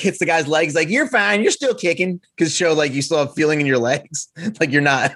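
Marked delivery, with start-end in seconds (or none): 0.9-2.2 light Southern drawl on a chiropractor's line; a hint of a Southern doctor, playful; Southern accent. Podcast host imitates chiropractor reassuring a patient, light Southern accent. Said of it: "Why is every accent you do a Southern one".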